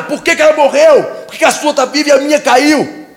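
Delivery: loud, high accusing shout; furious confrontation. Retold or imitated. Neighbor shouts at the other whose tree survived, furious. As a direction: demand answers, shouting in outrage.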